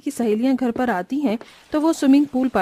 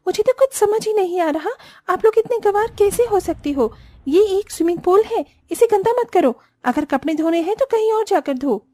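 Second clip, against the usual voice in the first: higher, louder indignant scolding; sister-in-law scolding Sheetal, shrill and loud; 10 semitones higher, 3 dB louder than usual. Acted. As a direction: snap at her for dirtying the pool. Rubi scolds Sheetal at pool.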